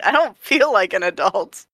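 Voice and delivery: normal talking voice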